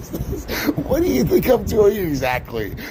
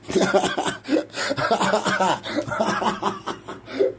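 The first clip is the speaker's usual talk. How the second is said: breathy rising laugh; helpless silent laughing; long wheezing laugh; 4 semitones higher than usual. Real, not acted. Host can't stop laughing, long wheeze.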